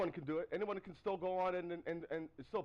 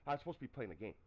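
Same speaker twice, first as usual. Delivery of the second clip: ordinary talk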